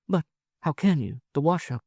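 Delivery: high woman's voice, hesitant; doubting woman. Acted. Wife doubting her lover can leave the gold, hesitant.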